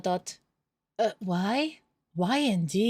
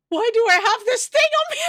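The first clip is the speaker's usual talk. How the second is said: much higher, louder, frantic; panicked shriek; 12 semitones higher, 7 dB louder than usual. Acted. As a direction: shriek in disbelief. Discovers elephant on body.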